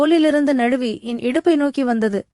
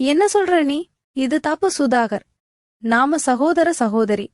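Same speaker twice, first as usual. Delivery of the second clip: plain reading voice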